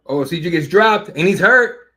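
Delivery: excited shout. Real, not acted. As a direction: burst out at the knockdown. Fighter dropped during live call.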